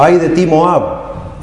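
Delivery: slow, high, drawn-out ay of doom; prophetic woe. Shown as a demonstration. Preacher voicing a prophet's threat, slow and high. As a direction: cry it out like a prophet pronouncing judgment.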